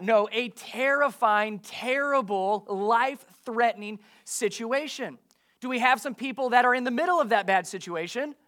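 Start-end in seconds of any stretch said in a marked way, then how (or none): 0.0-5.2 TERRIFYING, TERRIBLE, LIFE-THREATENING each slow and weighty; emphatic preaching. Real, not acted. Preacher hammering home the danger.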